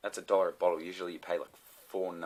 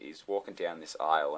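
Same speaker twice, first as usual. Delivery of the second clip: ordinary talk, nothing marked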